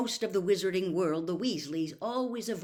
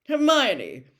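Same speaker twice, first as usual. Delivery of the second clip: higher, louder pompous lecture; 5 semitones higher, 12 dB louder than usual. A narrator's reading. Percy lecturing Hermione about house elves, pompous. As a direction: lecture her from on high. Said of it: "said Percy pompously".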